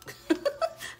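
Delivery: a quick breathy high laugh; short embarrassed giggle; sheepish laugh. Real, not acted. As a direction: laugh at your own mix-up. Laughs at her own mistake.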